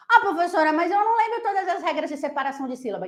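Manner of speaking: high, rushed pupil's whine; complaining student. Acted. Student making excuses to the teacher, high and complaining. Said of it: "Aí você fala assim".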